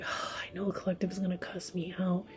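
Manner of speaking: soft, sighing acted dread; resigned dread. Partner expecting to get cussed out, softly resigned. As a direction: sigh it out quietly, bracing for a telling-off. Voicing someone dreading being told off.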